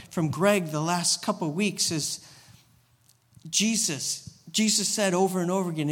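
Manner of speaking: normal talking voice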